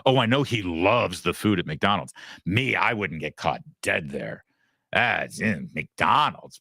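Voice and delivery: mocking looking-down-the-nose sneer; hammed-up haughty disdain; snide elitist snob. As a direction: imitate a snobby anchor looking down on fast food. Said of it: "snide looking down her nose and her elitist snobby".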